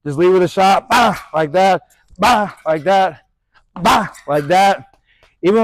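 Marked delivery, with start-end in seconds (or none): 0.9-1.2 short, sharp "bah" punch noise; punch sound. Shown as a demonstration. Boxing coach showing a quick shot, punch sound. 2.2-2.5 short, sharp "bah" punch noise; punch sound. Shown as a demonstration. Boxing coach showing a quick shot, punch sound. 3.8-4.1 short, sharp "bah" punch noise; punch sound. Shown as a demonstration. Boxing coach showing a quick shot, punch sound.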